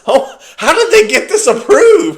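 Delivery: high, loud, laughing disbelief; mock outrage. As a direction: act scandalised that it got a second season. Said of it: "so I'm like".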